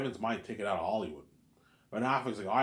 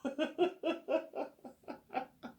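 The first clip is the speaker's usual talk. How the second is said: high breathy mock sob sound; airy falsetto wail. Mocking imitated crying.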